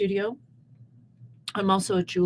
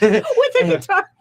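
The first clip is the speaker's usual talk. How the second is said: laughing; 12 semitones higher, 7 dB louder than usual. Real, not acted. Laughing at the memory.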